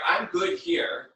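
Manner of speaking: ordinary talk, nothing marked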